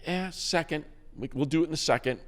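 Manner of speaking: playing the casual GM, brushing it off; lightly voiced team exec, breezy. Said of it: "the medical just is like".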